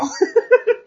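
a short high laugh. Real, not acted. Preacher laughing at himself about playing dolls. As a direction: laugh at his own doll joke.

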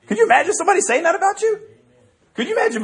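high, fast, laughing wonder; amazed. Real, not acted. Preacher asking the congregation, high and amazed. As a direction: lift it up in delighted disbelief.

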